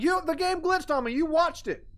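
squeaky defensive protest; indignant protest. Real, not acted. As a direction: plead your case to the viewer. Protesting a failed jump.

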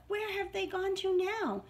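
plain reading voice

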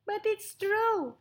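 high, pleading mouse voice; little mouse, earnest. Acted. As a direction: protest as a tiny mouse.

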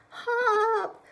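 high, loud warning call; shouted. Acted. Bear shouting to the bluebirds. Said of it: "he shouted to the rabbits".

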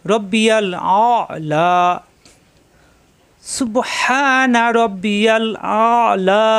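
drawn-out tajweed recitation; chanted model reading; slow correct recitation. Shown as a demonstration. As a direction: stretch each sound slowly. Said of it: "আমরা যদি এভাবে পড়ি".

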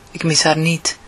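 plain reading voice

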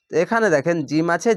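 normal talking voice

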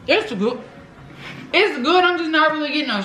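covering mouth while chewing; talking while chewing. Real, not acted. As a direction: mouth partly full. Seen 3: Takes cupcake bites before, chews with pursed lips, covers mouth.

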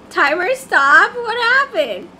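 ordinary delivery

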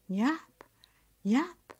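high, loud call with a rising end; lilting, questioning call; calling tree. Acted. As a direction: lure her over by name. Magic tree calls out to Nyap.

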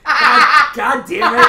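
high, loud exasperated cry; loud mock fury through laughter; laughing outburst. Real, not acted. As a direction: explode in laughing frustration.

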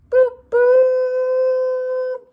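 high, loud boop boop horn; tugboat horn. Pretend. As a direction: toot like a boat.

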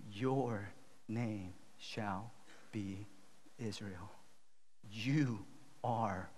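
very slow, low and hushed; solemn reassurance. Real, not acted. Preacher telling listeners they are God's children, quiet and solemn. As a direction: let each word settle softly. Preacher repeats declaration to congregation.

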